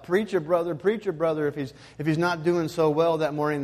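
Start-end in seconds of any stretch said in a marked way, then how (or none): 0.0-1.5 mild mock call-out, pitched up; half-raised congregational holler; imitated congregation yell. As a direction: lightly imitate a church member cheering on the pastor. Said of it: "they yell out".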